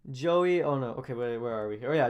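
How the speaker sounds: ordinary delivery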